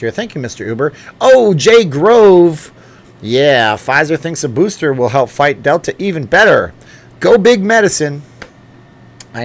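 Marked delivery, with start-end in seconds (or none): 1.2-2.7 high, loud, drawn-out greeting; excited shout-out. Real, not acted. 3.2-8.2 loud, high cheer. Real, not acted. Cheering a donor's chat.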